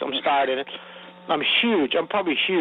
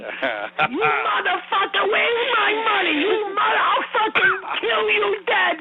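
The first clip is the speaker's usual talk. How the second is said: furious mob shout; slower than usual. Retold or imitated. Mob actor flipping out in the scene, screaming rage. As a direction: scream it like you mean to kill him. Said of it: "He's supposed to flip out on me".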